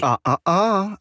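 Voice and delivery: coy teasing no; teasing refusal. Acted. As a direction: wag a finger playfully. Teasingly refusing to reveal plan.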